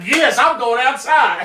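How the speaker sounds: very high, slow, laughing put-on voice; mock eager reply. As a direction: stretch it out high with a laugh, overjoyed. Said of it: "I'd be like".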